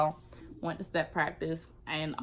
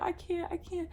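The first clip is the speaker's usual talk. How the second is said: high, soft sobbing whimper; 12 semitones higher, 6 dB quieter than usual. Retold or imitated. Retold sobbing to guard.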